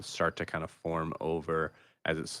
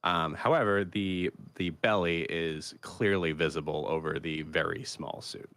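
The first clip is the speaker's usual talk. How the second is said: usual speaking voice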